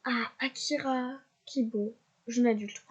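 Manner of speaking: usual speaking voice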